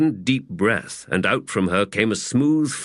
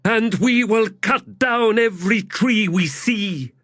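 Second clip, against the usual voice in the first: high, booming giant woman; giant warrior woman; 13 semitones higher, 3 dB louder than usual. A narrator's reading. Teblor woman's voice.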